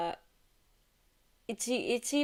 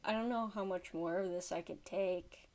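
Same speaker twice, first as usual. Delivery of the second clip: low, soft and worn down; subdued, defeated exasperation; weary exasperation; 3 semitones lower, 7 dB quieter, faster than usual. Real, not acted. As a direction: say it quietly, at the end of your patience. Worn down by creepy clip.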